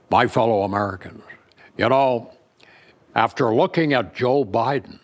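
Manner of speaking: comic presidential impersonation with deliberate pauses; Richard Nixon. Acted. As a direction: address the nation as the impersonated president, slowing into the punchline. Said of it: "the only bit I do on Nixon in my act".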